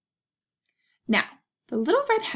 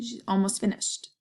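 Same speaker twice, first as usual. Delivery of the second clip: plain reading voice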